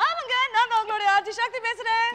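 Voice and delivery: high-pitched, over-keen RJ self-introduction; shrieky presenter greeting; shouty radio host. Acted. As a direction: announce yourself to the caller at an overexcited high pitch. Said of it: "அதுக்கு ஏமா இவ்வளவு கத்தி பேசுற?".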